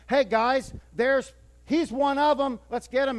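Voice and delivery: rally the crowd against him; calling out. Retold or imitated.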